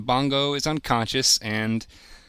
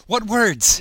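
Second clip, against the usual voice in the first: loud and high; 8 semitones higher, 6 dB louder than usual. Real, not acted. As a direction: repeat it louder and higher. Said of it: "Never mind".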